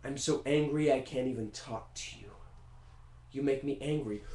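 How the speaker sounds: held-back anger through low quiet words; low simmering grumble; seething Beethoven. Retold or imitated. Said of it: "Beethoven's just like".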